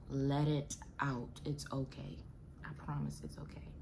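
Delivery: soft, hushed comforting tone; gentle reassurance. Real, not acted. Mother comforting other new mothers, softly reassuring. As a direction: reassure her quietly, voice dropping low in volume.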